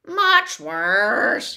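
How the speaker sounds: loud bird, wistful; longing. Acted. The bird answers Milo, loud and wistful. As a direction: sigh it like a homesick bird. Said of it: "he said longingly".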